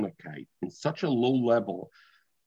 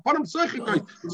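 excited realization; 11 semitones higher than usual. Shown as a demonstration. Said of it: "now I understand".

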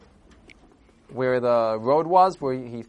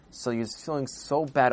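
ordinary delivery, nothing marked

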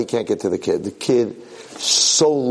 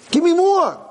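very high, urgent demand; eager demand; 4 dB louder than usual. Acted. Prince wanting more of the memory drink, eager demand. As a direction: cry out for it excitedly.

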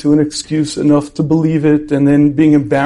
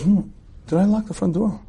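hushed, higher musing to oneself; acted quiet second thought; self-doubt; 4 semitones higher, 7 dB quieter than usual. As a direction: wonder under your breath. Man doubts himself on stairs.

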